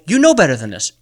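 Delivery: loud, high, forceful coach's challenge; hard coach's rebuke. Acted. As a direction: call them out at full voice, stretching the last phrase. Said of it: "I wanna be the voice to you go".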